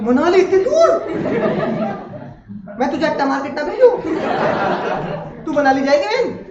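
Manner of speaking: hammed-up shocked mother; outraged mom. Mom reacts to Manali trip. (चौंकी हुई mummy की हँसती नकल)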